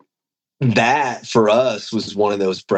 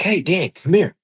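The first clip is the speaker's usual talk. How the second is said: calling out; 9 semitones higher than usual. Retold or imitated. Calling Dan over at the booth.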